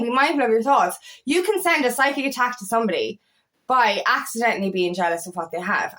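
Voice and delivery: ordinary talk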